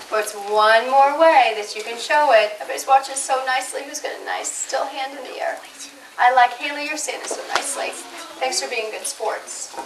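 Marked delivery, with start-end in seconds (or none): 3.8-5.6 whispering. Real, not acted. Teacher whispers to quiet class. 6.9-9.8 whispering. Real, not acted. Teacher praise whispered to class.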